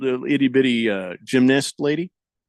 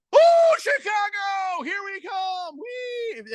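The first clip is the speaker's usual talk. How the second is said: very high, shrieking fangirl squeal; hammed-up high-pitched shriek of joy; mock squealing excitement; much slower than usual. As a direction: squeal it like a giddy fan who can't contain herself. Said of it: "And then one minute later".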